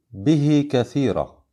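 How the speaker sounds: "به" lengthened to bihee before "كثيرة"; short connecting madd. Shown as a demonstration. Teacher models the minor connection rule, "به" with lengthened final ee. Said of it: "ومقدار مده حركتان".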